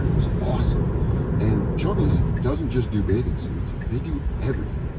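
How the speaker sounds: ad read. Acted. Ad read in show.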